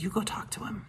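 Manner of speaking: soft breathy whisper aside. Retold or imitated. Funeral director nudging the pastor at the graveside, hushed whisper. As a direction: keep it hushed so the widower can't hear.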